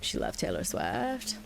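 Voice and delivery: slow Californian drawl with vocal fry; creaky, stretched-out Californian accent; Casey. Retold or imitated. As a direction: draw it out like a vocal-fry Cali girl. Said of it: "she talked like this".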